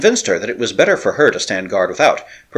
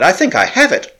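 high and eager eureka; thrilled at solving the puzzle; husband telling his wife his discovery, excited; excited realisation; 9 semitones higher, 3 dB louder than usual. A narrator's reading. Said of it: "I cried".

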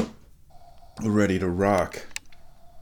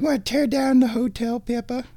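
cat talk; 12 semitones higher than usual. Real, not acted. Asking cat Pippa.

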